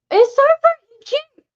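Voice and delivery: high, loud, slow squeal of delight; excited squeal. Real, not acted. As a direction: gush over it like it's the cutest thing ever. Gushing over a handmade card.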